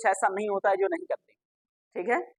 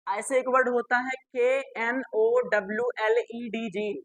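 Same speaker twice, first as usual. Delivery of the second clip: ordinary delivery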